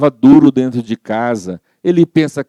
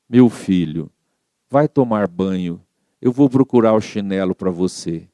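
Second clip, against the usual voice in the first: gentle mother; 4 semitones lower than usual. Acted. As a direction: soothe an angry son. Mother answers angry son gently. (mãe serena e carinhosa)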